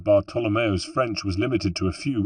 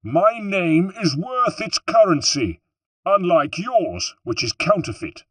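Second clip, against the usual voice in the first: higher, slow defiant retort; raised voice; 10 semitones higher, 3 dB louder than usual. A narrator's reading. Bartolomeo hitting back at de Valois before the troops, raised voice. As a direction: throw the insult back at him. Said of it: "raising his voice".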